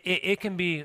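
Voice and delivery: ordinary talk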